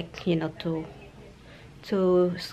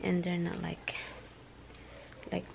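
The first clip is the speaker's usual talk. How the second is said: whispering; 12 dB quieter than usual. Real, not acted. Whispering to not wake.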